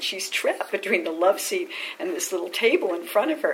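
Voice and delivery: ordinary delivery, nothing marked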